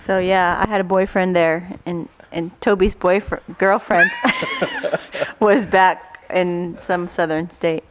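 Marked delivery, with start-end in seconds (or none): 4.1-5.3 real very high laugh; high squeal of laughter. Real, not acted. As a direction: squeal at your own slip. Laugh at slip.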